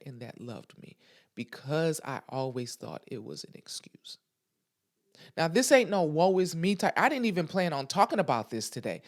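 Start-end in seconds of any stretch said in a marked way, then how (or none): 0.0-4.2 whispered confession. Real, not acted. Telling viewers a secret, whispered. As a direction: drop to a whisper on the admission.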